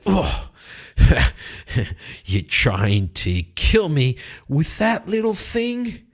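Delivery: high, scornful voice with a sneering laugh; mocking knight. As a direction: laugh at his puny dagger. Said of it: "the Kettle Right goes".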